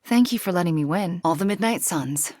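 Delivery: normal talking voice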